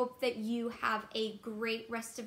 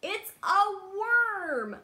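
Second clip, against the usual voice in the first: excited reveal; 8 semitones higher, 8 dB louder than usual. Acted. Reveal to children.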